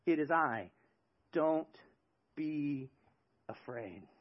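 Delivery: soft, slow, calming, fading away; gentle reassurance. Acted. Jesus to his disciples, softly reassuring. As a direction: soothe a frightened friend. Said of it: "He says".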